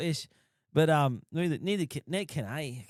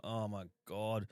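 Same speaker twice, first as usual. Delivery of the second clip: appalled; 6 semitones lower, 9 dB quieter, slower than usual. Retold or imitated. First bite of deep fried food.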